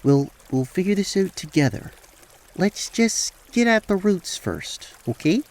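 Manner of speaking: tender, hesitant reassurance; reassuring Rael. Acted. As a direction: soothe a crying friend gently. Voices Rael comforting Clover.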